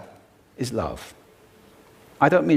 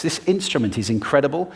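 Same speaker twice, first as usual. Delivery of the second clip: ordinary talk, nothing marked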